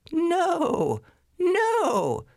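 very high, frightened little goat; tiny goat squeal. Acted. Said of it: "said the little billy goat".

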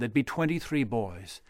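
usual speaking voice

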